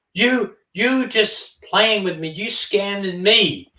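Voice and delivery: high, slow, put-on caller voice; accusing scammer. Imitating the scam caller accusing her of scamming him, high and slow. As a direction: drag it out like the caught scammer.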